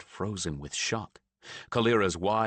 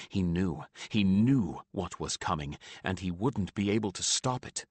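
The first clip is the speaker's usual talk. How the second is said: plain reading voice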